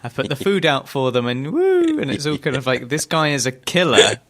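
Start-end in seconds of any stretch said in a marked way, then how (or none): 1.5-2.0 high, drawn-out "woo"; sustained, louder "wooo" of pretend delight; playful woo. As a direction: let out a long high mock-excited woo. Mimics the cutesy fish feeding.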